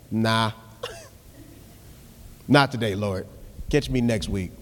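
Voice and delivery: low, soft, dismissive mock excuse; casual stalling voice, low and soft; lazy brush-off. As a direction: laughingly shrug God off. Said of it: "we be like".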